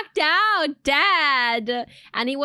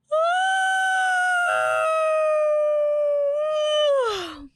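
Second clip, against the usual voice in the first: huge yawn. Shown as a demonstration. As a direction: let out a massive yawn. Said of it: "massive yawn... you just need to go".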